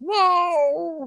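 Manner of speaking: very high, loud drawn yowl; cat's cry. Retold or imitated. Voicing the freezing stray cat, high pained cry. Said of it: "and he's like".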